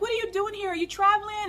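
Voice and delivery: high-pitched imitation of an eager subscriber; excited fan. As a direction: ask it high, like a delighted fan.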